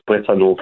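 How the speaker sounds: Bretonneux with a French ending, X silent; French pronunciation. Shown as a demonstration. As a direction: give Bretonneux its French sound. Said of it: "How would I say that in French?".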